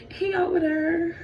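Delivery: high, drawn-out little reply with a laugh; giggle, then sing it out high and slow; giggly answer. Acted. Eve answering the flirting snake, high and giggly.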